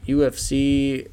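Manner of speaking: ordinary delivery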